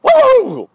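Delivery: very high silly squeals; weird noise. Shown as a demonstration. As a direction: squeal freely to loosen up. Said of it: "Make a weird noise".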